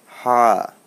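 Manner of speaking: "হা" from mid-throat, loud and held; deep throaty HA. Shown as a demonstration. Teacher showing where the letter is made, deep throaty "হা". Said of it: "একটু deep থেকে হবে, গলার মাঝখান থেকে".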